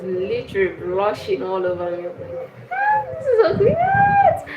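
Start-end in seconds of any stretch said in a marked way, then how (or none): 2.7-4.5 high gushing squeal. Retold or imitated. Gushing at being admired.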